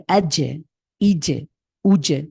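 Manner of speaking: আজে, ইজে, উজে with tongue pressed hard to the palate; strong doubled j with an echoing release in আজে, ইজে, উজে; hard bounced j. Shown as a demonstration. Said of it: "খুব শক্তভাবে লাগিয়ে".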